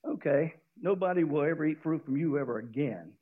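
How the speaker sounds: quiet firm curse on the tree; calm and firm. Acted. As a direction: state it plainly without anger. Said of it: "he doesn't get upset... doesn't mince words".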